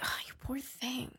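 very soft, pitying murmur; a hushed thought with a laugh in it; mock pity. Looking at her boyfriend with pity, very softly.

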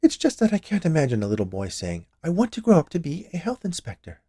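a hint of a doubting woman, rising high on the quote; lightly voiced confectioner. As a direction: pitch up for the imagined child's wish. Confectioner teases the inspector.

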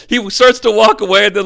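normal talking voice

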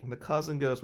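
normal talking voice

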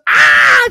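very high, loud delighted scream; imitated shriek of a thrilled fan; grandma's scream. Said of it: "And she goes".

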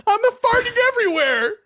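high-pitched comic despair; exasperated whine. Real, not acted. Co-host confesses a brain fart, whining in exasperation. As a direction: wail it in high, helpless frustration. Exasperated at his mental blank.

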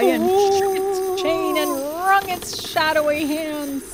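narration wailed like a ghost; ghostly wail. A narrator's reading. Narrator voicing the specter's cry, mock wailing. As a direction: let the words rise into a spectral cry. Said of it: "raised a cry".

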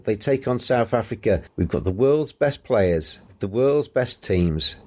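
slow, measured broadcaster sign-off; radio outro. Acted. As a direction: read it like a radio presenter's sign-off. Host's podcast outro read.

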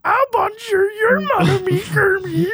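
hammed-up crying diva swoon; Miss Piggy voice. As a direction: gush at Kermit like Miss Piggy.

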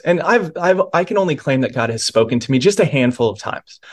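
ordinary talk, nothing marked

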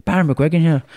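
loud attempt at an Irish accent; hammed-up Irish phone introduction; Barry McGuigan. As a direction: announce yourself in a put-on Irish accent. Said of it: "this is my attempt at Irish accent".

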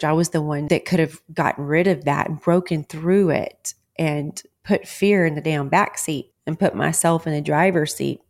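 ordinary talk

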